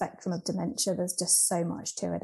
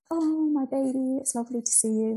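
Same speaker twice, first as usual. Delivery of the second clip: higher and louder, tender delight; light up at the sight of her daughter; loving mum; 6 semitones higher, 3 dB louder than usual. Retold or imitated. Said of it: "she was like".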